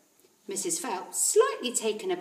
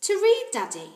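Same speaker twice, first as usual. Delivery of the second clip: put-on higher, sweet child's voice; little girl asking; 11 semitones higher, 7 dB louder than usual. Matilda asking her father for a book, higher child's voice. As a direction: ask politely like a small girl.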